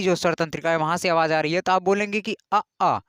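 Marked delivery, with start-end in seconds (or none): none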